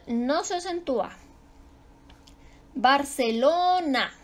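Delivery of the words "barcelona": "Barcelona" slow with a stretched lo; teacher leaning on LO in "Barcelona"; penultimate stress. Shown as a demonstration. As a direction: draw out the o in "Barcelona".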